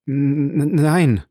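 frightened stutter; stammering. A narrator's reading. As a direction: stammer on the first sound before getting it out. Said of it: "stammelte Bertuccio".

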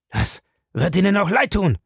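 high, agitated cry of warning; agitated shout. A narrator's reading. Krieger threatens the man who threw him down, agitated. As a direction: call it out hotly, pitched well up. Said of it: "rief Krieger erregt".

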